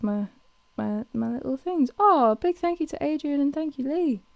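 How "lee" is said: normal talking voice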